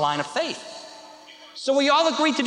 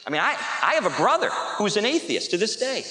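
ordinary talk

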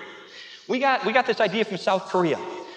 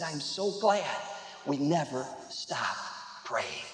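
soft, low and slowing to almost nothing; hushed gratitude; 8 semitones lower, 10 dB quieter, slower than usual. Retold or imitated. Woman thanks pastor after the baptism, hushed gratitude. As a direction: let it sink into a quiet, halting close. Grateful woman's words, retold softly.

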